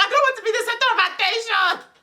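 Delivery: high squealed protest through laughter; hammed-up, laughing complaint. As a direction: retell it in a high, giggly whine. Own reaction retold, laughing.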